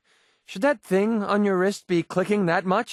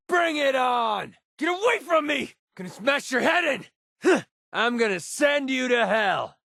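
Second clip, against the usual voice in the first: high shouted threats; combat taunts; 7 semitones higher than usual. Acted.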